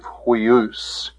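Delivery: "huius" ending in an OOS sound; pronunciation demo. Shown as a demonstration. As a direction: model "huius" clearly. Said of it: "The pronunciation of this".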